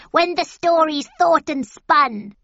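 high, loud witch voice. A narrator's reading. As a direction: call it out like a hag. Witches chanting in turn.

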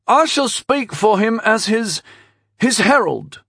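put-on high, unsure boyish voice; high-pitched young herald; adolescent boy herald. A narrator's reading. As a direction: proclaim it like a boy not used to his voice. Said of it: "He didn't seem used to the sound of his own voice yet".

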